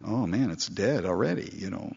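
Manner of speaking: normal talking voice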